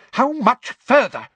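high, loud, irritated demand; high-pitched angry question. A narrator's reading. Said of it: "demanded Umbridge angrily from behind him".

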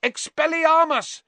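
high, loud yell; yelling spell. Acted. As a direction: fire the spell at him. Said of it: "he yelled".

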